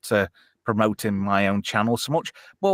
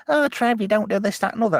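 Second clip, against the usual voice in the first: lightly voiced naggers, pitched up; imitated complainers; 8 semitones higher than usual. Streamer quoting people who complain to him, high mimicked nagging. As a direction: mimic people's fussing in a higher voice.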